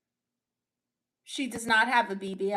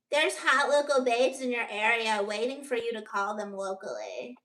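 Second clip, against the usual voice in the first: mock nineties sex-line commercial; phone line ad voice; 3 semitones higher than usual. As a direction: pitch hot local babes like a late-night ad. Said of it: "comedy skit like that once".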